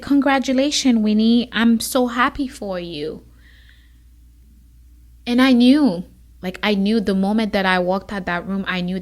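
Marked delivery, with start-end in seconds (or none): 0.0-3.2 excited mentor. Retold or imitated. Mentor congratulates her.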